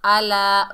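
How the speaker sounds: आला loud, with plain glottal a; hamza mistake. Shown as a demonstration. Teacher shows what to avoid, plain-vowel आला. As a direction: begin आला with hamza in place of ain. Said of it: "हमज़ा बिल्कुल भी नहीं पढ़ना".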